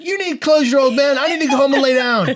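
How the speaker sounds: high, loud mock argument; exasperated retort. Son retorts to dad pushing him to speak, exasperated. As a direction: protest in comic exasperation.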